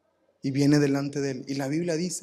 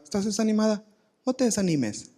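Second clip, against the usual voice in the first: higher sing-song parody of a shallow husband; mock husband advice; 6 semitones higher than usual. Husband comforting his discouraged wife, mild mock sing-song. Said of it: "La ves así que desanimada".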